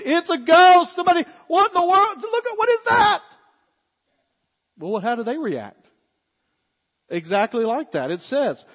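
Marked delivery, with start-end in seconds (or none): 0.0-3.2 high, loud yell of terrified disbelief; panicked screaming. Acted. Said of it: "You're yelling, you're screaming".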